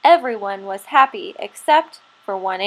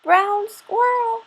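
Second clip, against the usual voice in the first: put-on slow, high old grandmother squirrel voice; 11 semitones higher, slower than usual. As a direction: call him gently like a wise old granny.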